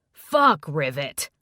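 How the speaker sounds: loud curse, then dropping; exasperated curse. A narrator's reading. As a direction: blurt it out, dismayed. Friend's shocked outburst.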